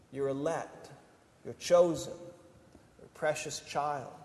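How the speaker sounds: ordinary delivery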